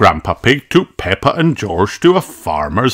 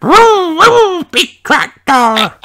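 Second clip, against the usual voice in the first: very high, slow vroom, louder; tiny piglet engine voice, much higher; toddler George; 5 dB louder, slower than usual. A narrator's reading. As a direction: boast like a proud toddler. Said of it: "said George".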